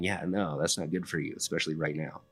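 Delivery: soft, low, hushed aside; God's quiet no, murmured low; mock whisper. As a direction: drop low and soft, quick and confidential. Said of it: "sometimes he's just whispering".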